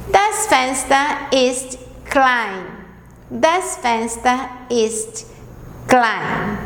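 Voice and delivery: Das Fenster ist klein, slowly and clearly; slow model sentence. Shown as a demonstration. Teacher rereading German sentences slowly for beginners. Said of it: "Vou ler mais uma vez as quatro frases".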